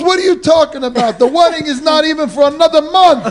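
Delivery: laughing retelling. Real, not acted. Retelling father's wedding mix-up.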